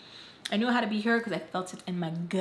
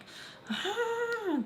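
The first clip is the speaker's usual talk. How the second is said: high, drawn-out mock sob; imitated crying wail. Girlfriend texting her boyfriend, high imitated cry. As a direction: put on a high crying whine.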